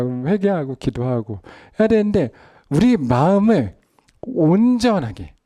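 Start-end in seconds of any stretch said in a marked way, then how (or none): 2.7-5.3 drawn-out, high pleading emphasis; slow emphatic build. Acted. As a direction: stretch each word upward. (천천히 높여 강조하며)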